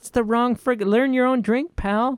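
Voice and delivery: high, scoffing, put-upon; indignant inner voice. Retold or imitated. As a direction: think it sharply and high. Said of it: "And I'm thinking".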